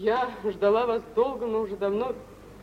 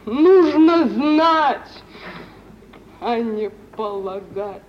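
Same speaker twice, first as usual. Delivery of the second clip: indignant; 12 dB louder, much slower than usual. Acted. Лариса гневно упрекает Паратова.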